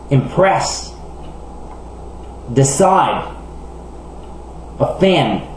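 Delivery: "Admit", "Announce", "Begin", "Arrange", "Impress", "Decide", "Offend", each stressed on its last syllable. Shown as a demonstration. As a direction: lean on the end of each word from "Admit" to "Offend". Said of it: "l'accent est normalement sur la dernière syllabe". (de « Admit » à « Offend », accent sur la dernière syllabe)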